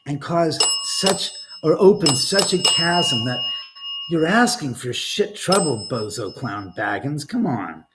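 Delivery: ordinary delivery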